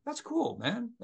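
high, delighted; impressed. Retold or imitated. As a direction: pitch jumps way up in wonder. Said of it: "I'm going".

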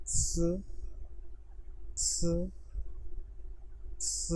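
स said as a clean s hiss; Chinese c sound. Shown as a demonstration. Teacher drilling the pinyin c sound with students, clear. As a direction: model स clearly and evenly. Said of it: "मेरे साथ repeat करें तीन मरतबा".

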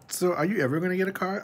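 high-pitched mimicry; mocking nosy people. Vlogger imitating people who bring it up, mockingly high. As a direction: parrot the nagging question up high. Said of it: "I hate it when people always bring it up".

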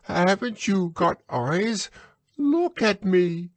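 high squeaky shout; shouting Piglet. A narrator's reading. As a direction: squeal it out in frustration. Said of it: "shouted Piglet".

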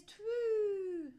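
long high twoo; owl hoot. A narrator's reading. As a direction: draw the word out like a hoot. Imitating the owl's hoot.

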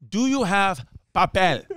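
"papel" said the Spanish way in a mock Spanish accent; a light mock Spanish accent on "Do you have papel?". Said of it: "She hit them with".

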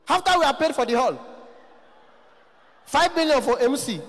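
high-pitched indignant haggling; poor man. Retold or imitated. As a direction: protest the price in a high voice. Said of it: "voice is always very high".

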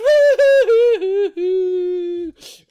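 high, loud wavering boo-hoo; mock wail. Baby Bear crying over broken chair, loud mock wail. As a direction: bawl like a heartbroken cub.

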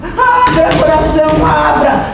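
loud, high pleading cries; crying and shouting. Acted. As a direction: beg her to open up, yelling. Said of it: "começou a chorar e gritar". (Marina gritando e chorando, "abra")

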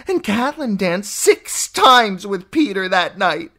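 a sister reliving her jealousy; obsessed, raving aunt. Acted. Lysa's jealous memory rant.

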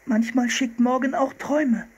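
put-on very high girl's voice; young girl. A narrator's reading. Sister explaining to the seal hunter, high girlish voice. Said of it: "meinte sie".

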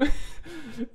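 loud, high-pitched giggle; high laugh. Real, not acted. Reader tickled by the word peeved, high laugh. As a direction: burst out laughing.

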